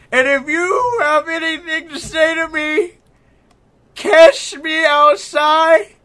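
high, loud, slow wrestling promo; Ric Flair impression. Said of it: "the nature boy, Ric Flair".

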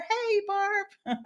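high, drawn-out hello to a friend; delighted high-pitched shout-out; calling out a greeting. Real, not acted.

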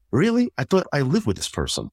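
a squeaky, disbelieving jump up on Really; mock disbelief at booking time with a partner; incredulous. People scoffing at making an appointment, incredulous. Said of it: "And people are like".

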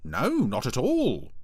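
high, loud, drawn-out denial; chirpy exaggerated reassurance; teasing Brian. A narrator's reading. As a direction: stretch it out over-politely.

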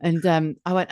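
ordinary talk

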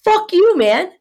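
high, loud, heated rant; re-enacted angry outburst; retold snapping. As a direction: lash out at him, pitch soaring then easing. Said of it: "I'm just snapping at this point".

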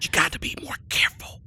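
laughing whisper. Acted. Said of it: "Why are you whispering?".

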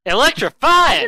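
high, slow, loud announcer call; hyped announcer shout. Acted. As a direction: belt it like a ring announcer. Echoing the word with big energy.

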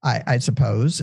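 ordinary talk, nothing marked

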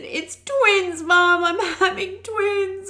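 overwhelmed telling mum; bursting into tears. Retold or imitated. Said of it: "I just burst into tears".

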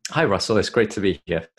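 ordinary talk

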